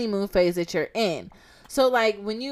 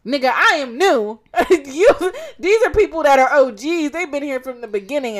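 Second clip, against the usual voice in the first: laughing exclamation; 6 semitones higher, 7 dB louder than usual. Real, not acted. Exclaiming she is new.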